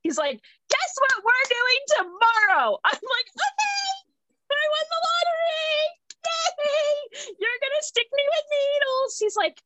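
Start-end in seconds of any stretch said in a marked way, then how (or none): 0.7-2.8 high, slow, playful tease; teasing doctor. Retold or imitated. Doctor teasing the patient about tomorrow, slow and high. 3.3-9.2 very high, squealing fake cheer; mock excitement. Patient mocking joy at getting needles, squealed very high.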